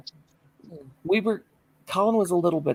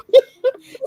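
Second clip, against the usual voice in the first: high, loud, crying laugh; shrieking laugh; 11 dB louder than usual. Real, not acted. Woman laughing at driving off in the wrong car, shrieking laugh. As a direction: laugh till it squeaks.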